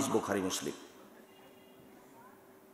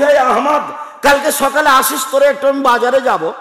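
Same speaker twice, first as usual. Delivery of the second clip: loud, high call across the yard; 10 semitones higher, 9 dB louder than usual. Retold or imitated. Preacher calling to the van man, a loud call. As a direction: hail him from a distance.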